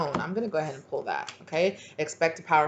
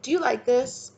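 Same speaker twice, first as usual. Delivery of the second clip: high-pitched, probing question; acted leading question, pitched up; manipulative person; 7 semitones higher than usual. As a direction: ask it high, fishing for an answer. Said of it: "type of person that would be like".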